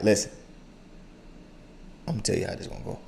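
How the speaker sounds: low, soft father warning; calm but means business. Retold or imitated. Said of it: "That's the tone right there".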